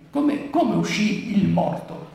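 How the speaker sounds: ordinary talk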